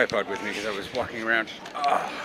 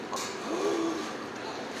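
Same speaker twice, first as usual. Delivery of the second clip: gasp; 5 dB quieter than usual. Real, not acted. Shocked at cheap prices.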